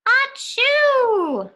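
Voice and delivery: big high-pitched mock sneeze; a theatrical storybook achoo; loud high sneeze. Sneeze in picture book.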